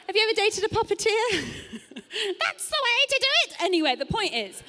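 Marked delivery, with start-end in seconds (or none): 0.1-3.5 high, fast Punch-style puppet voice; puppet squeak. Acted. Comic jokes about dating a puppeteer in a puppet voice.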